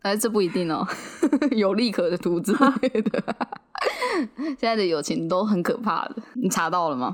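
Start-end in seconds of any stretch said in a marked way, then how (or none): 3.3-4.5 high giggle. Real, not acted. Joke about friendship for profit.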